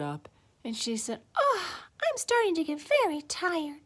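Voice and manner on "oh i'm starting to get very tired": put-on high little-girl sigh; sleepy child voice, pitched up; tired Goldilocks. A narrator's reading. Goldilocks after the chair broke, high tired voice.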